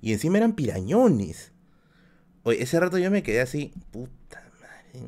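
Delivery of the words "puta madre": a slow, breathless whispered swear; whispered curse. Retold or imitated. Realizing the boys he told off are older, a slow whispered swear. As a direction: breathe the curse out almost silently.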